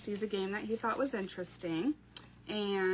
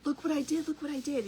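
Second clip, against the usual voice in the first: put-on excited little boy; 5 semitones higher than usual. Mom voicing her excited son.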